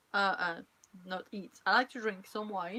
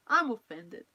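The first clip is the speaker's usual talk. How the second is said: mock offended; 9 dB louder than usual. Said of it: "I'm kidding".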